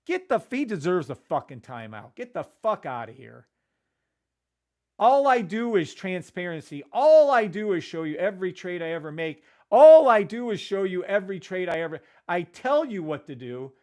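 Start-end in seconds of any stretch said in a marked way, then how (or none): 5.0-11.7 loud, heated defence building to a yell; furious streamer snapping back at a critic; angry rant. Real, not acted. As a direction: hammer it home like you've been insulted. Defends himself against a viewer.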